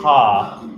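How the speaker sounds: "ha" as a loud breathy H onset; breathy H sound. Shown as a demonstration. Director demonstrating the H onset of hush. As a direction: push air through the "ha". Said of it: "we're putting the H in there".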